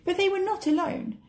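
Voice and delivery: plain reading voice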